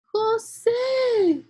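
very high, loud call for a brother; high-pitched storybook girl shouting; urgent shout. A narrator's reading. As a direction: yell the name for help. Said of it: "gritó Julia".

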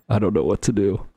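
usual speaking voice

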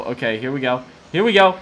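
usual speaking voice

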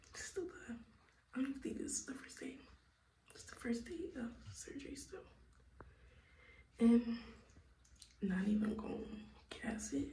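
unvoiced late-night whisper. Real, not acted. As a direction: whisper so the house sleeps.

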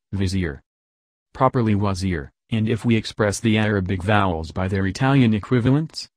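plain reading voice

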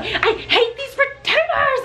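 high, slow, half-laughing mock rant; mock angry outburst. Retelling ripping out broken retainers, a laughing mock tantrum. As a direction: act out fake fury while laughing at yourself. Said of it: "I'm like".

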